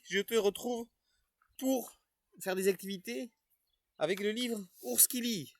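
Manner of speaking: put-on a cheerful character greeting the children; Supermaître puppet voice.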